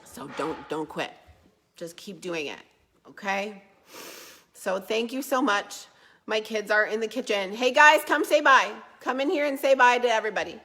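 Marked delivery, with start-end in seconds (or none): 0.0-3.6 soft encouragement. Real, not acted. Tender encouragement to struggling viewers. 7.6-10.6 calling loudly into the next room. Real, not acted. Calling kids from the kitchen.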